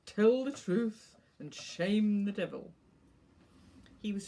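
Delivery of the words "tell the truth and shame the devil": sententious and reproachful, a bit louder. A narrator's reading. Dog lecturing his secretive owner, sententious reproach. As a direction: preach the proverb with a mild reproach. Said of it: "said reproachfully and sententiously".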